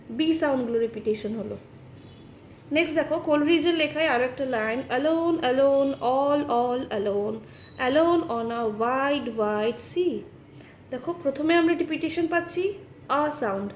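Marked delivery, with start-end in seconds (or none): 4.9-10.2 very slow, drawn-out verse; poem recitation. Acted. Teacher reciting Coleridge's line for the class, slow drawn-out recitation. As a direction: linger on each repeated word.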